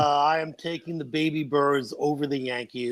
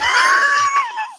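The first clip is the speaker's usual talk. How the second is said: loud laugh; 7 dB louder than usual. Real, not acted. Laughs at being called [name].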